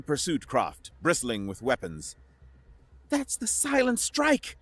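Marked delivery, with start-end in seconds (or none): 3.1-4.6 high tense pilot voice; urgent higher female voice; Jet's voice. A narrator's reading. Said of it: "Jet hissed".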